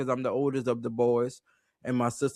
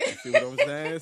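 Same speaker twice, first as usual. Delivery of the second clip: ordinary talk, nothing marked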